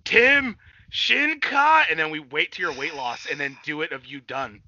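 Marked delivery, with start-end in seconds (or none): 0.0-1.8 high, loud, very slow anime cry; hammed-up battle shout; mock evolution call. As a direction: announce a transformation, stretched out. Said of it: "recorded a video of being".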